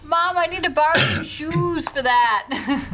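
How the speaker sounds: high, loud, stretched plea to mom; daughter hollering. Acted. Daughter asking mom to borrow shoes, hollered. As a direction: yell the request across the house. Daughter yells request to mother.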